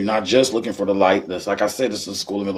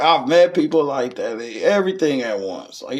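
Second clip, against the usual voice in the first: normal talking voice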